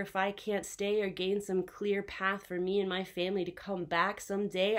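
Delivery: usual speaking voice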